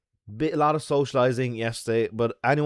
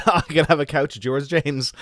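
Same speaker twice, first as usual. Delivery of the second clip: louder, higher, laughing as you speak; tickled, words riding on laughter; 6 semitones higher, 4 dB louder than usual. Real, not acted. Laughs at offer of couch.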